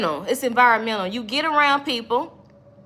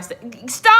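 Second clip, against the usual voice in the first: hollering; 4 dB louder than usual. Real, not acted. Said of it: "I'm hollering at you".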